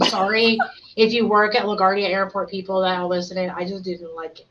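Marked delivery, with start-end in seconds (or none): none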